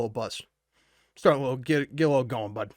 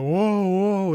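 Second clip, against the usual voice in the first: dazed amazement; 6 semitones higher, 7 dB louder than usual. Retold or imitated. Stoned, spinning in his mind.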